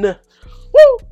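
high, loud "woo"; excited whoop. Real, not acted. Host thrilled by his favorite song, excited whoop.

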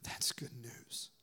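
slow hushed whisper. Real, not acted. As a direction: breathe it out in awe. Preacher whispers after joke.

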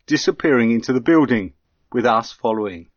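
ordinary delivery

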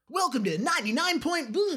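high, bright radio jingle intro. Retold or imitated. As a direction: announce it like a radio DJ. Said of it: "on radio shows when they do the".

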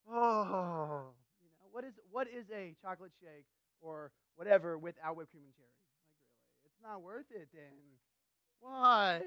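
hammed-up disbelief at a plain shake; mock incredulous complaint. Worker mocking a customer's order, loud disbelief. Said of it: "sometimes I'm just like".

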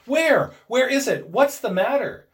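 high, loud, startled questions; alarmed. A narrator's reading. Husband woken by his wife's cry, alarmed. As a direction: demand answers in sudden alarm. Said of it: "He started up in alarm".

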